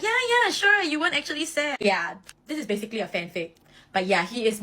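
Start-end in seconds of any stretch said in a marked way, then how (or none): 0.0-1.8 placating teammates. Retold or imitated. Scared teammates humoring the captain, high and placating. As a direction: humor him so nobody gets beaten up. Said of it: "they will just be like".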